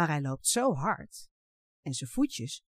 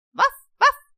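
high, loud woof woof; dog barking; 14 dB louder than usual. A narrator's reading. Takkie the dog bursts in barking, high and loud. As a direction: bark like a small excited dog.

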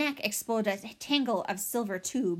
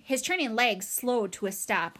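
ordinary delivery

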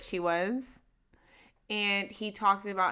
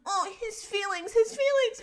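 mock pity; 9 semitones higher than usual. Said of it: "I'm like".

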